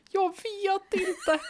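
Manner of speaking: high, slow, panicky wail with a laugh; boy squealing in panic over the copies; Gunnar wailing. Acted. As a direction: wail it out as the copier runs wild. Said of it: "tjuter Gunnar".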